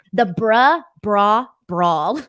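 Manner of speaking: The bruh, bra, brawl with bruh loud and punchy; bruh, bra, brawl said one after another; tongue twister read aloud. Shown as a demonstration. Host reads a viewer's bruh, bra, brawl comment aloud. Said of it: "that's a good tongue twister".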